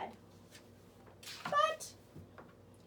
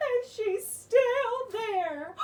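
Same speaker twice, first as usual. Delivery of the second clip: much higher, fast, louder cub voice; Baby Bear; 6 semitones higher, 6 dB louder, much slower than usual. Acted. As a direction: squeak it out like a small cub. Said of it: "said Baby Bear".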